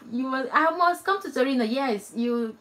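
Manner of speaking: usual speaking voice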